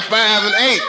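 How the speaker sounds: ordinary talk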